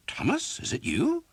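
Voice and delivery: very high, startled question; suddenly recognize your friend; shocked recognition. Acted. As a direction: priest recognizing the beaten archer. Priest recognizes the victim.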